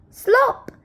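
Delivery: high, loud "Slop!" sound effect; splashy slop. A narrator's reading. Reading to kids, the bucket lands: a high, loud "Slop!". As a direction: pop it out like a splash.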